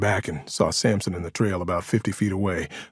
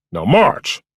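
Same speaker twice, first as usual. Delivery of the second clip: higher, louder command; barked order; 5 semitones higher, 8 dB louder than usual. A narrator's reading. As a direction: order a prisoner to move. Officer orders prisoner to walk.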